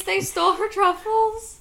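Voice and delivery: can't believe it; disbelief. Real, not acted. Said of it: "I'm gonna cry in real life".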